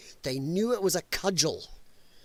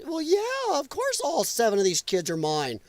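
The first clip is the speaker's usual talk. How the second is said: mock assurance; 7 semitones higher than usual. Acts migrant answering border agent.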